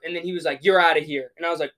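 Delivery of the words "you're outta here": louder imitated ref's call; mock referee ejection. Ref orders player off the court, louder mock call.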